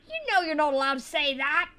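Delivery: strict scolding. Retold or imitated. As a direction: scold loudly like a strict teacher. Acted scolding by strict teacher.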